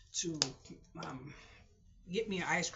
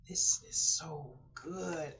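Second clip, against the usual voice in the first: savoring quote; much slower than usual. Retold or imitated. Quoting a guest savoring food.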